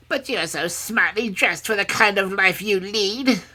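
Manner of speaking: high, loud, needling crone; carping old woman. A narrator's reading. Old woman questioning the princess's smart dress, carping.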